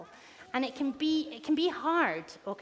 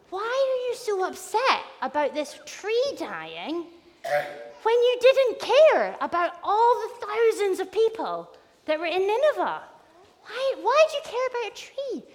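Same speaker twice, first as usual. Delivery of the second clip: higher, incredulous questioning; acted reproachful appeal, pitched up; pleading challenge; 7 semitones higher than usual. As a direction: press the question with rising urgency. Acting God challenging Jonah.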